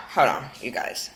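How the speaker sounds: soft whispered hold on. Real, not acted. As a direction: drop to a hushed aside. Aside to the audience.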